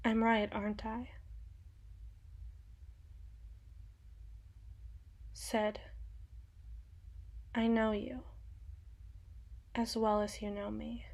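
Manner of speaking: quiet menace. Acted. Cutting, intimate accusation.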